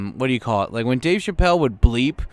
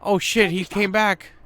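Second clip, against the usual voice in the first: worried character; 8 semitones higher than usual. Acted. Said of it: "He's like".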